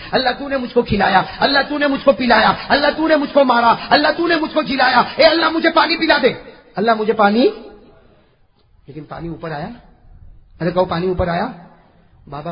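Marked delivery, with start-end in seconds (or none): 0.1-7.5 rising, loud litany to God; fervent supplication. Acted. Saint begging Allah for water, fervent building plea. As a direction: build each plea higher and louder. Preaches the translated prayer.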